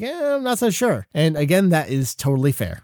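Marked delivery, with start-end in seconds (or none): 0.0-1.1 doubtful meh. Retold or imitated. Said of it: "people here in a row that are like".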